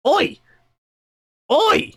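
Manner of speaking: loud, high goblin holler; bellowed high-pitched shout; drunken yell. Acted. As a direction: yell across the tavern to get attention. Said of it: "before yelling".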